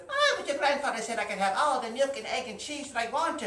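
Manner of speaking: leprechaun voice. Acted. Leprechaun pleads.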